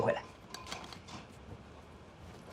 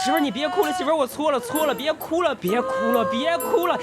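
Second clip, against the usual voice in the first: high-pitched, desperate apology; flustered husband pleading for forgiveness; frantic pleading; 9 semitones higher than usual. Acted. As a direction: beg and soothe in a panicky high voice. Husband begs crying wife to forgive.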